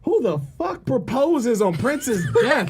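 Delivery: loud mock-outraged cry. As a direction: stretch out the outrage, half laughing. Outraged at proposal timing.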